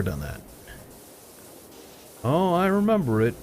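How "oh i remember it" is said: mock user. Mocking user's claim again.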